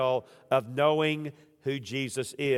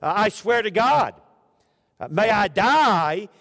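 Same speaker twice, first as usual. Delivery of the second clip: desperate sworn oath; 5 semitones higher, 6 dB louder than usual. Acted. Peter loudly swearing to the crowd he is not lying. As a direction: swear it loudly as if your life depends on it.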